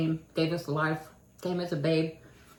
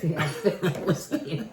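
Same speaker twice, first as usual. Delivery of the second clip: laughing. Real, not acted. Joking about whiskey cough syrup.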